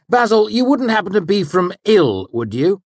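high, loud, needling master; sharp smile. A narrator's reading. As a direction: smile as you set the trap. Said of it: "smiling sharply".

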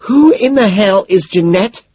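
high, loud, slow seething; angry hiss. A narrator's reading. Evelyn questions him on the phone, angry hiss. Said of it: "she hisses".

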